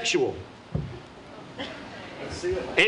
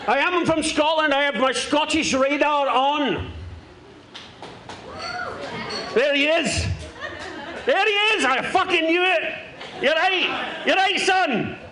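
mock Glaswegian comic voice, played broad; a lightly held Scottish accent; put-on Scottish accent; 5 semitones higher than usual. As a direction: talk like a brash Scotsman fresh off the plane. Holds a put-on Scottish accent until admitting he's London-born.

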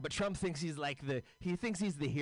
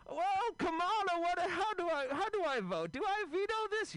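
put-on much higher, doddering old man voice; a befuddled president, pitched way up; confused old Joe. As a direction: play him lost and calling for help. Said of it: "So here's my impression of".

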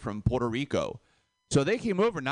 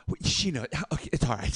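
ordinary talk, nothing marked

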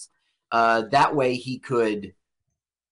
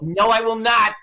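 mock indignant refusal, louder and higher; stubborn actor; 8 semitones higher, 5 dB louder than usual. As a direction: refuse flat out as a hammed-up stubborn star. Acting out Keitel refusing again.

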